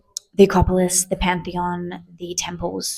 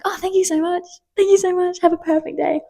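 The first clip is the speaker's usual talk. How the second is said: gushing thanks; 9 semitones higher than usual. Retold or imitated. Thanking the attendant, overjoyed.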